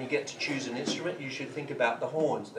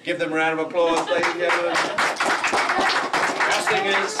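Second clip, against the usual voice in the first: loud, raised announcer call; calling for applause; 5 semitones higher, 10 dB louder than usual. Real, not acted. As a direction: whip up applause for the singers.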